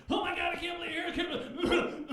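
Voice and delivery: high, loud, fast overjoyed yelp; excited dog. Pretend. Dog greeting owner at the door, ecstatic and shouting. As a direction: gush like a dog beside itself with joy. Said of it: "he's like".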